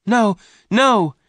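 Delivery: very high, loud cry of protest. A narrator's reading. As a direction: cry out desperately to stop them. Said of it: "Jane cried".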